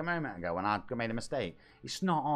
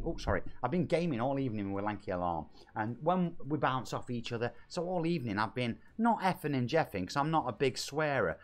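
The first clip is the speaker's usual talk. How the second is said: usual speaking voice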